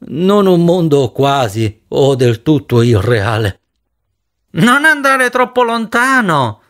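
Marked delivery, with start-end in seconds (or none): 4.5-6.6 high, drawn-out call at normal volume; yelling after someone. A narrator's reading. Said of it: "gli urlò dietro Jory". (richiamo acuto e prolungato)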